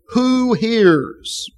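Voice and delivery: high scornful jeer; mocking sneer. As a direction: scoff it like they fear no one. Enemies mocking God, quoted.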